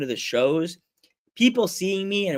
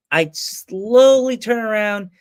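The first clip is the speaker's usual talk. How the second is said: slow, higher and louder storytelling; drawn-out suspense; 5 semitones higher, 7 dB louder, slower than usual. Retold or imitated. Teller building up the reveal, drawn out. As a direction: stretch SLOWLY out long and loud.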